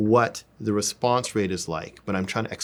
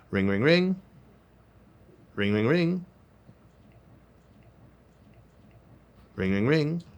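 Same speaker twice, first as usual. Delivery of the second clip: phone ring; 6 semitones higher than usual. Shown as a demonstration. Imitating phone ringing.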